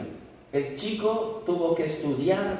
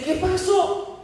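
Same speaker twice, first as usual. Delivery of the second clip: surprised; 12 semitones higher than usual. Acted. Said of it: "queda sorprendido".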